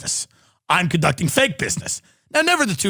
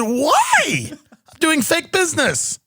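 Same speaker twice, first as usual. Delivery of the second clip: mock innocent; 10 semitones higher, much slower than usual. Retelling his dinner argument.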